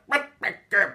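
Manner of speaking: high, wordless mangled gabble. Retold or imitated. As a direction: imitate an incoherent yokel squawk. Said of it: "the other one's like".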